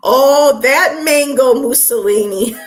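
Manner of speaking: loud, high, delighted outburst; gleeful exclamation. Real, not acted. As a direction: cry out in amused delight.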